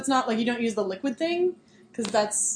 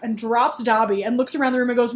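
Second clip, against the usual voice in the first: ordinary talk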